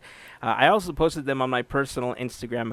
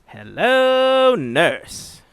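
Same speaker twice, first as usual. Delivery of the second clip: loud cartoon wolf-whistle greeting; Hello Nurse catchphrase; 8 semitones higher, 7 dB louder, much slower than usual. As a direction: belt it like a cartoon flirt. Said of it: "celebrate with how we were celebrating earlier".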